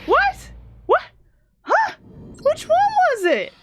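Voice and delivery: high-pitched disbelieving yelps; baffled. Real, not acted. Player cannot tell which bullet was right, high-pitched bafflement. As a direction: squeak each question in confusion.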